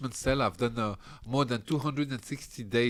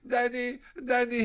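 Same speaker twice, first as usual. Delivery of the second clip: high calling of a name; calling him awake; 11 semitones higher, slower than usual. Retold or imitated. Cellmate waking him at seven, high calling voice. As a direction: call out to wake him, a touch higher.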